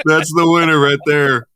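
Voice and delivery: high, loud, laughing approval; crowing through laughter. Real, not acted. Host cracking up at co-host's pyramid scheme title, laughing.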